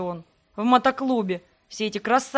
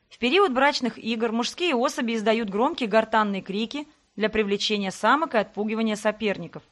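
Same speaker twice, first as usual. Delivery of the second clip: ordinary delivery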